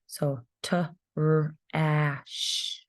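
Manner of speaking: "Trash" then split into "t", "r", "ash", each sound said apart; teacher sounding out a word for first graders. Shown as a demonstration. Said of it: "the tricky one ... the word trash".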